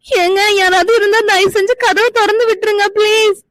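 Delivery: high, frightened pleading with a sob; trapped and begging through a shut door; panicked cry for help. Acted. Girl locked in the storeroom, crying to anyone outside for help.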